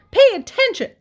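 very high, loud yell; frantic piglet shriek; screaming. A narrator's reading. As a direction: scream for someone's attention. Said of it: "screamed Wilbur".

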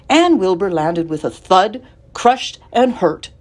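ordinary delivery

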